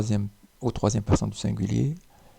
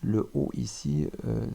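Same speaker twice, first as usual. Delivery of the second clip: ordinary talk, nothing marked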